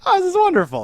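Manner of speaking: high, loud gush of fake delight; mock delight. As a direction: gush like it's the best news ever. His own delighted reaction retold.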